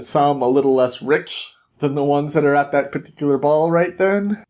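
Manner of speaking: ordinary delivery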